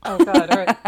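usual speaking voice

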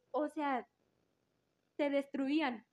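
normal talking voice

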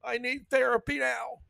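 high, hammed-up panicked whine; mock offended person. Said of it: "what he said".

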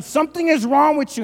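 high, loud comic accusation; mock outrage. Husband confronting his wife over the donut, mock outrage. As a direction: exclaim it in pretend disbelief.

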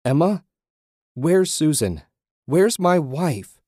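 high, loud, pleading questions; desperate demand. A narrator's reading. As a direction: cry out frantically for your wife. Desperate man demands his wife.

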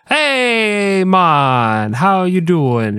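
a fake phone-psychic Jamaican accent that doesn't land, slow on the last words; mock Jamaican greeting, hammed up and unconvincing; bad Jamaican accent. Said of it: "they all have to speak with that Jamaican accent".

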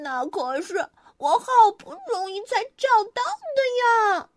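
high, distressed rabbit; upset rabbit crying out; dismayed cry. A narrator's reading. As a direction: wail over losing the bear. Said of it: "兔子喊起来".

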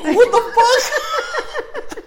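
high, drawn-out laughing what the fuck; laughing disbelief. Real, not acted. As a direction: stretch it out in shocked laughter. Reacting to friend crying.

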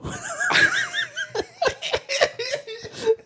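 long, high-pitched burst of laughter. Real, not acted. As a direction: crack up in a high squeal. Laughing at friend's reply.

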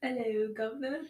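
hammed-up cockney greeting in British; playful, badly faked British accent. Asked for an example of her British accent, mock British. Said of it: "just speak in a British accent".

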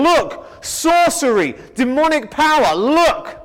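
a lightly voiced Pharisee crying sorcery, slow and pointed; mock accusation. Pharisees telling the people Jesus works by sorcery, slow mock accusing call. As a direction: point the finger and cry foul to the crowd.

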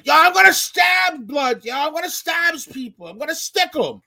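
high-pitched mock street yelling; hammed-up screaming tough guy; imitated screaming threat. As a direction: shout it high, then ease off while staying high. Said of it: "he's screaming".

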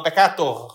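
"peccato" loud with the final R pulled out; drawn-out final R. Shown as a demonstration. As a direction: pull the R at the end of "peccato". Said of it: "Puxa o R no final". ("peccato" puxando o R no final)

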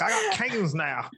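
slow, high show-off line; smug slow boast. Retold or imitated. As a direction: drawl it out like bragging. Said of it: "You're like".

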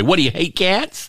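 high, mock-indignant question; mock listener complaint. Host mimicking a listener who writes in, high mock indignation. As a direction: imitate an offended cat lover. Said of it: "people who would write us and say".

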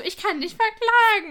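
high, loud and slow, puffed-up outrage; mock-indignant shout, drawn out; indignant threat. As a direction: threaten him like a wronged landowner. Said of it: "Und Alex so".

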